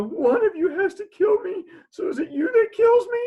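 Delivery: high, loud hammed-up wail; panicked child. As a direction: press them, near hysterical. Panicked child questions police.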